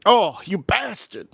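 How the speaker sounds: hammed-up cartoon reproach; South Park quote. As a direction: draw out the mock indignation. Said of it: "I love that on South Park".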